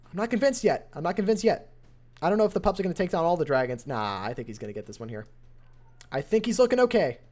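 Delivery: lively caster calling the raid live; energetic esports caster narrating a close match; play-by-play commentary. Acted. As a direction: call the action as it unfolds.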